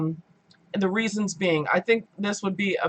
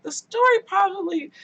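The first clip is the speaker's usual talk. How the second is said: high-pitched complaining start; exasperated thought; 12 semitones higher than usual. Retold or imitated. Vlogger voicing her annoyance with the audiobook. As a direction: squeal it in frustration. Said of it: "and I'm like".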